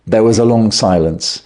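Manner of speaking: ordinary delivery, nothing marked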